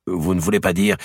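ordinary delivery

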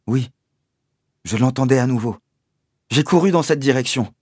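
ordinary delivery, nothing marked

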